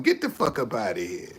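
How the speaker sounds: dropped low and soft, shooing them off; low dismissal. Real, not acted. Streamer kicking out a disruptive commenter, low dismissal.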